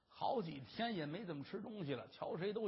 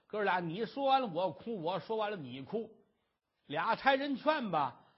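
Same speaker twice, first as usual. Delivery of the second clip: ordinary delivery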